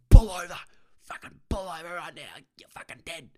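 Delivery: explosive yell tailing into strangled, hoarse threat; erupt, then rasp the threat without full voice; imitated violent anger. Enraged driver ordering the speaker to pull over, violent strained shout. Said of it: "a violent anger".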